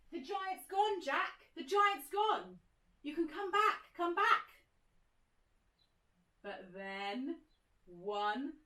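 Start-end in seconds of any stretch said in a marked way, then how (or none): 0.1-4.4 high, bright call to come back. Acted. Teacher calling hidden Jack to come back, high call.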